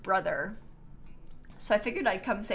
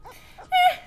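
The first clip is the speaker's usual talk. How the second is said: voicing the cat; 4 semitones higher than usual. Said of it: "he's like".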